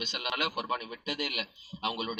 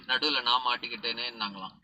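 usual speaking voice

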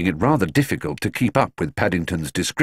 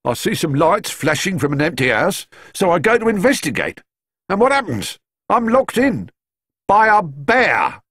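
higher, rising outrage, last words slowed; indignant policeman; 11 semitones higher, 4 dB louder than usual. A narrator's reading. Locked-in constable complains about being trapped by a bear, indignant. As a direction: build to aggrieved disbelief.

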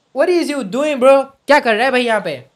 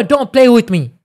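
ordinary talk, nothing marked

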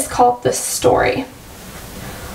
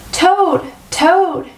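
higher, sing-out call of a name; understated shout, pitched up; mild storybook shout; 5 semitones higher than usual. A narrator's reading. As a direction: call out to rouse a friend indoors, without real volume. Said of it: "shouted Frog".

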